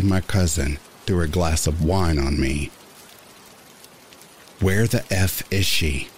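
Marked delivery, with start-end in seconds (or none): 4.6-6.1 a lightly voiced angry snap; understated hungover venom; spat out. A narrator's reading. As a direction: spit the question out with mild contempt. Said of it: "I spat".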